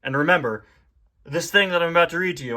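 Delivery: usual speaking voice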